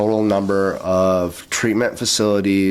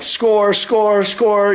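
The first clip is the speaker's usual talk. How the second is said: high, loud, drawn-out cheer; excited exclaiming; 12 semitones higher, 5 dB louder, much slower than usual. Retold or imitated. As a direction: celebrate a big win, each word held high. Acting out elated scoring.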